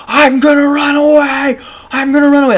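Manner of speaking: high, loud yelled threat; screaming threat. Retold or imitated. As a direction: scream it like a meltdown. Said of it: "it was straight up like that video".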